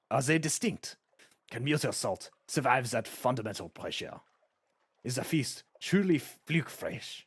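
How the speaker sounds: super-French chef, thick and hammy; excited babbling in a cartoon French accent; terrible French accent. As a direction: put on an exaggerated, deliberately bad French accent. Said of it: "a super terrible French accent".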